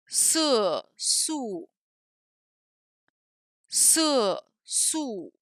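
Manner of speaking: "Su song", "Se su", each syllable falling steeply; slow Chinese falling tones on Su song, Se su; Chinese syllable demo. Shown as a demonstration. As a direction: drop the pitch on every drawn-out syllable. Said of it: "je vais prononcer des syllabes chinoises".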